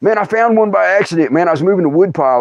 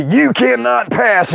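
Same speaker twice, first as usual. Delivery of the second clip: slow, higher mock-epic decree; dramatic proclamation; 7 semitones higher, much slower than usual. Facing us as Ifrit comes, mock-epic proclamation. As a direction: proclaim it grandly, every word drawn out. Quoting a dramatic film wizard line.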